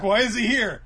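high, loud startled question; mock alarm. Villains seeing the Wizard King arrive, mock alarm. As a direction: blurt it out in acted disbelief.

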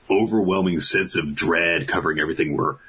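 ordinary talk, nothing marked

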